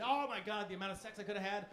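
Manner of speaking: ordinary talk, nothing marked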